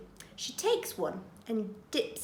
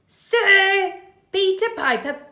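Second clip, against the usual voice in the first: coaxing burglar; 9 semitones higher, 8 dB louder than usual. Acted. Burglar tests baby with tongue twister. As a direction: dare the baby with it. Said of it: "says Burglar Bill".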